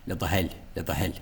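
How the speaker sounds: "Hull" said as "hell" in "Le de Hull"; dialect variant. Shown as a demonstration. Teacher showing another dialect, "Hull" as hell. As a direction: swap the o of "Hull" for an e. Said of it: "becomes an E".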